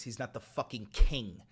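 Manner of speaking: ordinary talk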